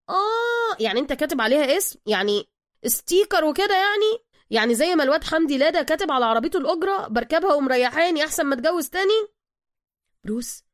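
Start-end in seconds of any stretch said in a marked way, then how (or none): 0.1-9.3 high, knowing wise-guy tone; smug know-it-all. Acted. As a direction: act clever and pleased with yourself. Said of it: "سوكا متذاكيا".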